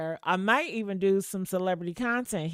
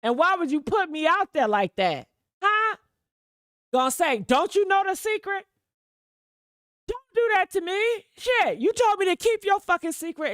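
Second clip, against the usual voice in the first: high, loud heated rant; angry retold rant; 10 semitones higher, 5 dB louder than usual. Streamer reliving yelling at the girl, loud and angry. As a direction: blast her like you did then. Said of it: "I said".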